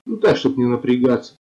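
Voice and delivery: ordinary talk, nothing marked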